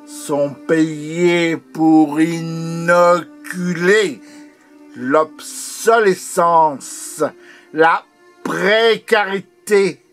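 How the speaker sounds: forceful poetic denunciation; impassioned declamation. Acted. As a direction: hammer out each key word. Poem builds in intensity.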